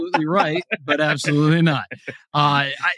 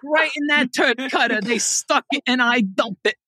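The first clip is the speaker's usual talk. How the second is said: higher-pitched Boston guy impression; Boston accent; 6 semitones higher than usual. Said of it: "can you do that in a Boston accent".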